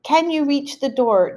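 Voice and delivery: reassuring mom. Retold or imitated. Daughter reassuring her deaf mother through a door. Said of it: "I'm like".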